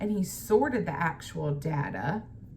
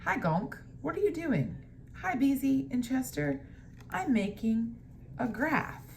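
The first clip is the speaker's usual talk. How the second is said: a brighter, higher Gonk; higher character voice; 8 semitones higher than usual. A narrator's reading. Teacher voicing Gonk higher for students. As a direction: lift the voice up for Gonk.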